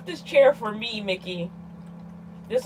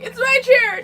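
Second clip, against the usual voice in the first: high, indignant mock shout; mock yell; 11 semitones higher, 4 dB louder than usual. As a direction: re-enact an over-the-top squawk of ownership. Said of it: "I'm like".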